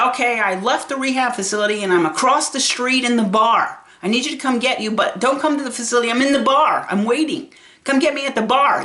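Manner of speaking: mock elderly mother, pushy and high; imitating demanding mom. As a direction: play the impatient mom calling from the bar. Voicing her demanding mother.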